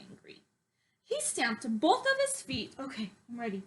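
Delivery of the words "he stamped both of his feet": ordinary delivery, nothing marked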